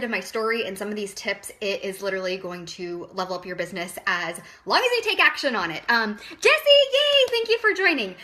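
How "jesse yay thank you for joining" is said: excited thanks. Real, not acted. Thanking a viewer who joined.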